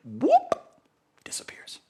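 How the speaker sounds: slow "whoop", then a breathy whisper; fading whisper. Acted. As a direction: let the word vanish into a whisper. The show vanishing, magic moment.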